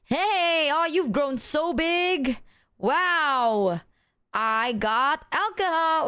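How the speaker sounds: a hammed-up, high, slow party uncle greeting. Uncle greeting the kids at a party, hammed-up and slow. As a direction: play the jolly uncle who just walked into the party. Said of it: "that one uncle that comes to the party being like".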